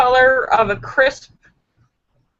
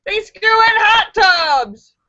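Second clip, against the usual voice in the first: higher, louder, slow punchline; punchline delivery; 9 semitones higher, 5 dB louder, slower than usual. Acted. Host landing the Funny Friday punchline, high and slow. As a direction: land the joke slowly.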